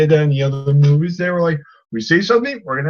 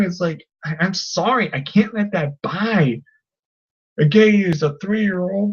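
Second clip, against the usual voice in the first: normal talking voice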